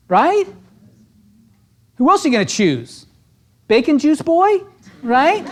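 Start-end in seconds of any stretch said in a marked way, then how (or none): 3.7-5.5 high mock voice for the rival. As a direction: sneer at the other agent, pitched up. Mocking a rival agent.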